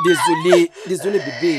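blurting sorry in a panic; loud frantic apology. Acted. Husband panicking at his wife in labour, loud frantic apology. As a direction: shout the apology, flustered.

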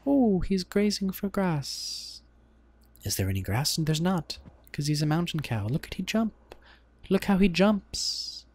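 high, marvelling nature narrator; David Attenborough. Acted. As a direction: gush over the animal in a bad British narrator voice. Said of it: "get my David Attenborough voice on".

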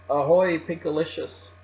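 high, loud friendly hail; calling out. A narrator's reading. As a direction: call "ahoy" from a distance. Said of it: "Aqua called up".

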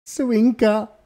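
playful wink. Pretend. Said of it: "du hast mir zugezwinkert".

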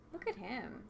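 hushed whisper, barely voiced. Real, not acted. Player whispers to her partner about the stranger, whisper. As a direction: lean in and whisper it.

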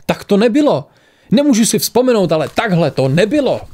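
high, agitated protest; crying out. A narrator's reading. Accused woman cannot remember but denies it, crying out. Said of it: "vykřikla Mnemosiné".

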